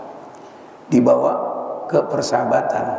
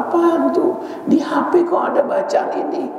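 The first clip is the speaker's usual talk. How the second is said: suspicious wife; 3 semitones higher than usual. Retold or imitated. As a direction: play the jealous wife who found a text. Wife finds message on phone.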